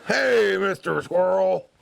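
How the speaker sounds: loud, drawn-out hearty greeting; big bear voice. Acted. Big bear hailing a little squirrel, loud hearty voice. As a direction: call out big and slow like a storybook bear. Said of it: "And Mister Bear goes".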